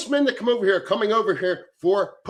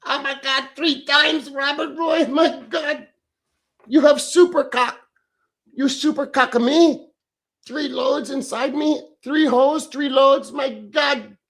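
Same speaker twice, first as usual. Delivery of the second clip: put-on higher, camp girlish voice praising a donor; mock girl; 9 semitones higher, 4 dB louder, slower than usual. Thanking donor Robert, a higher mock swooning girl voice.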